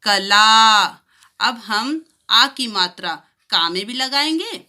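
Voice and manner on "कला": कला, louder, with ला drawn long; stretched ला in कला. Shown as a demonstration. Teacher to children, कला with a long ला. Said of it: "थोड़ा सा खिंचेगा".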